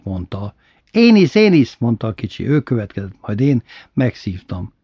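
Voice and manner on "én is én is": put-on high, eager child's plea. A narrator's reading. Little brother begging to smoke too, high and eager. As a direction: jump in like an eager little kid.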